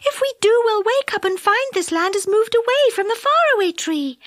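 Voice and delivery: high, anxious fairy; Silky voice. A narrator's reading. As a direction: warn them in a worried high voice.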